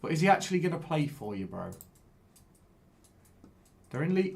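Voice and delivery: ordinary delivery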